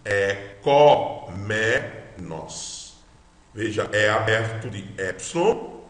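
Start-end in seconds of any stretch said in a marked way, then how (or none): 0.1-2.9 very slow, separated syllables. Shown as a demonstration. Teacher repeats the Greek word, syllable by syllable. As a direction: draw out "có" and each syllable. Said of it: "Como é que nós temos isso?".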